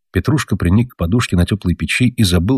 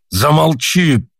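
drawn-out shout, higher and slow; 8 semitones higher, much slower than usual. A narrator's reading. Father shouting at mother to be silent, drawn-out yell. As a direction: bark it out, stretched and high. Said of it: "закричал отец на мать".

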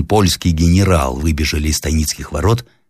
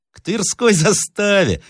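slow, high echo of the words; high, drawn-out bewilderment; puzzled repeating; slower than usual. A narrator's reading. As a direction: repeat it slowly, as if baffled. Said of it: "повторил мастеровой, почесывая голову".

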